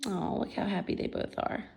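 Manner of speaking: ordinary delivery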